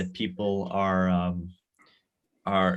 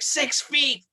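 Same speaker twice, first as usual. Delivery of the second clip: high-pitched mock holler; imitated yell, pitched way up; retold yell. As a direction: re-enact yelling out the car at kids. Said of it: "but I yelled at them".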